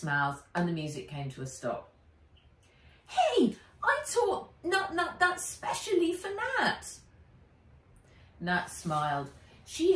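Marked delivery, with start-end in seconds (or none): 3.1-7.0 put-on very high indignant boy; hurt little boy protest. Henry protests.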